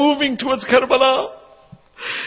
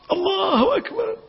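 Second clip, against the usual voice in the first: tearful cry; 7 semitones higher, 4 dB quieter than usual. Real, not acted. Weeping after martyrdom story.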